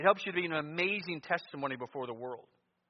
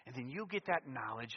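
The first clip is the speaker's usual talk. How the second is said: ordinary delivery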